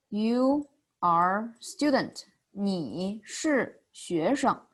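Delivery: slow, word-by-word 你是学生; slow statement. Shown as a demonstration. Teacher contrasts statement with question, slow and separated. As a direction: spell out the statement slowly, word by word. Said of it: "Add a ma, that's a question maker".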